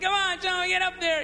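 high-pitched mock trainer command; urging animal trainer. Comedian imitates trainer driving the elephant on, mock urging.

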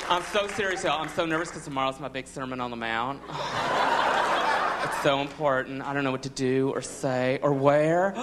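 put-on lightly campy Southern accent, gossipy; mild effeminate Southern accent; effeminate Southern Jesus. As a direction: gossip like a Southern queen about Lazarus. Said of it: "Think of Jesus going".

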